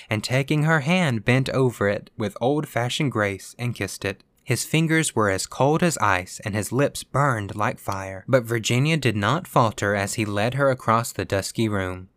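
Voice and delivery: plain reading voice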